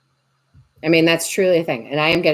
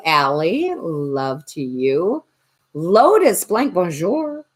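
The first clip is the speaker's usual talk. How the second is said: a lightly put-on French accent, slower; bad French accent; much slower than usual. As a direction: say bonjour with a playful fake French accent. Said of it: "I don't have great accents".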